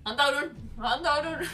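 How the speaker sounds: high, held-back call; mock surrender cry. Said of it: "voit mä huutaa". (korkea leikkihuuto, antautuen)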